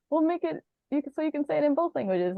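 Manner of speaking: high, quick mock-parent voice; playful high-pitched quote; imitating hopeful parents. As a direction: rattle it off high, gently sending up the parents' plan. Imitating parents' naming plan, jokingly.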